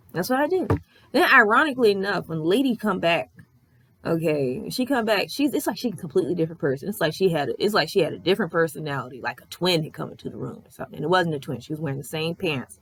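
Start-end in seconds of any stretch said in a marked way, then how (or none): none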